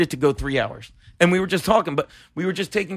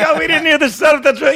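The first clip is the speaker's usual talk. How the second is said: mock innocent; 10 semitones higher, 4 dB louder than usual. Feigning innocence to the angry comic.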